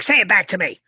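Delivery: mother demanding her son repeat it; stern mom. Retold or imitated. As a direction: make him repeat it.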